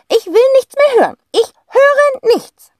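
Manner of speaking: very high and loud, slow at the end; exasperated aunt. Acted. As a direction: cover your ears and shout it high. Tante Rotz figure voice.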